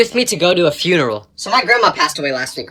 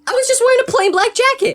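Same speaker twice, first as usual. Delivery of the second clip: much higher, whining protest; high indignant squeak. Acted. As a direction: squeak it out, offended.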